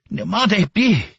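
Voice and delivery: loud high curse spat out in pain; cursing. Acted. Beaten Ma cursing at the officers, loud. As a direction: lash out with the curse while being beaten. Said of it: "He curses".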